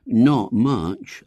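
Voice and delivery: borrowed phrase said with pride; proud murmur. A narrator's reading. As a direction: savour the catchphrase like a boy. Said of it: "murmured William proudly".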